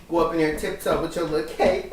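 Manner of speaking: ordinary talk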